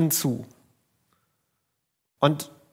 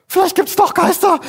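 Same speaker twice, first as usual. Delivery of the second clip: high, panicked wail of realization; a fisherman losing his nerve, half crying; frightened cry; 13 semitones higher, 3 dB louder than usual. Retold or imitated. Fischer sieht ein Gespenst und jammert hoch vor Angst. Disciples realize it's a ghost.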